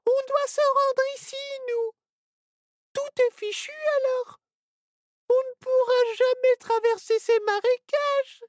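put-on very high little-girl voice near tears; a tiny girl giving up hope; little girl, dismayed. Little girl tells friends all is lost, very high and dismayed. Ciboulette despairs.

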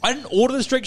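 high-pitched retold complaint; worked-up comic re-enactment of arguing; exasperated protest. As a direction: protest it indignantly, voice lifted. Acted quarrel over wrong order.

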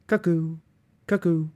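high, loud sing-song cuckoo calls, each falling; cuckoo clock call. A narrator's reading. The mantelpiece clock cuckooing the hour, high loud bird call.

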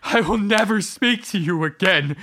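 high, loud furious vow; furious shout. Acted. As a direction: hurl it at her. Husband rages at wife.

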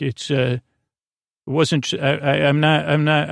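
ordinary delivery

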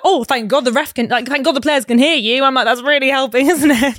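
mock him with loud sarcasm. Sarcasm at shouting boyfriend.

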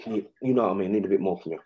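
soft, low mumbled brush-off; a hint of a shifty coach; evasive coach. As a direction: dodge the question quietly. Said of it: "He's like".

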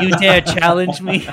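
high, very loud mock-indignant pirate; challenging shout. Pirate captive to the crew that dared her, indignant shout. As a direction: roar it as a comic challenge.

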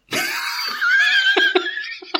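a high, squealing burst of laughter; shrieking laugh. Real, not acted. Host shrieking with laughter at his own joke, squealing. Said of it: "He did it again!".

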